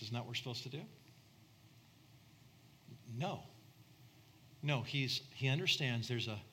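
ordinary talk, nothing marked

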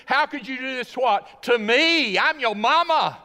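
loud, high, indignant mother; a mother hurt by her son's going off; Mary scolding. Retold or imitated. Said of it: "You remember what she said?".

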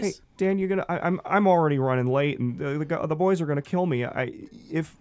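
high, hurried and fretting; flustered Gary. Acted. Gary telling Dan he's late, high and hurried. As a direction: rush out the excuse, running late.

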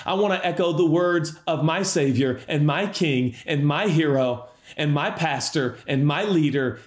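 ordinary talk, nothing marked

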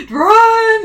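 high, loud, drawn-out yell; mock panicked shout. Viewer yelling at characters to leave the building, mock panicked shout. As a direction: holler a warning, stretching the word.

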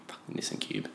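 whispered, very soft aside; muttered aside. Real, not acted. Storyteller adding an aside about the little car, muttered. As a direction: mutter it under your breath.

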